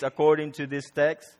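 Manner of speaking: ordinary talk, nothing marked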